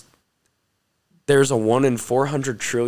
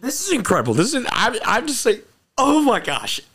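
much higher voice, awed and amazed; overwhelmed amazement; 11 semitones higher than usual. Real, not acted. Speaker recalls being healed by God, overwhelmed amazement. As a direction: marvel in a high, excited voice. Awed telling of own healing.

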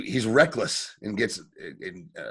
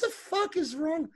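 exasperated viewer; 5 dB quieter than usual. Retold or imitated.

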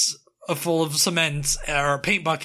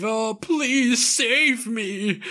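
slow, high pleading voice; begging for my life; 7 semitones higher, much slower than usual. Acted. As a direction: beg to be saved, drawn out. Villain begging in quicksand.

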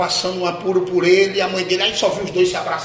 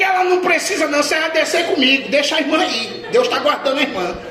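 insistent retort; 9 semitones higher, 5 dB louder than usual. Retold or imitated. Pastor insists worker come down.

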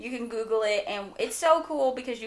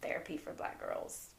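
whispered; 14 dB quieter than usual. Shown as a demonstration. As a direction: breathe the name out as a whispered reminder. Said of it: "like we said".

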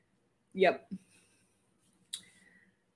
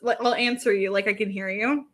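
ordinary talk

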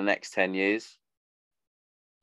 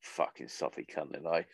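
self-mocking laugh; 3 semitones lower than usual. Real, not acted. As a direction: laugh off your own sentimentality. Laughs at own soppy toast.

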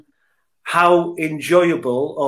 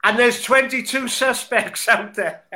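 higher-pitched mock American detective accent; Columbo; 7 semitones higher than usual. Guest finishing his football joke, in a mock American accent. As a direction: deliver the punchline in a bad American accent. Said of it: "In your Colombo accent".

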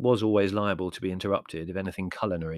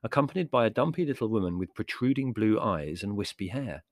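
plain reading voice

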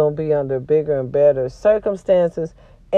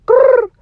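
sharp growl, much higher and louder; angry growl; 8 dB louder than usual. Real, not acted. Sick and frustrated, growling at her followers. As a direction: let out a mad growl. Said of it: "I'm so mad".

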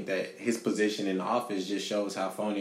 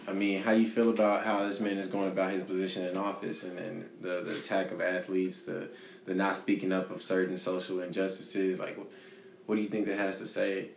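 ordinary delivery

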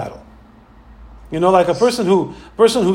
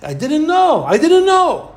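high, singsong feigned innocence; mock Bilam's lame excuse; Bilam's slogan, whined high; mock innocent plea; 8 semitones higher than usual. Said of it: "Bilam used this idea".

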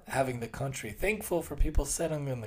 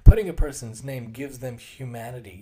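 usual speaking voice

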